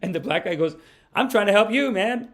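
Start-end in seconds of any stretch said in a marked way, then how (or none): 1.1-2.3 high, insistent heckler's holler; loud protest. Retold or imitated. Guy in the audience insisting he's helping, loud protest. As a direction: imitate a heckler defending himself, loudly.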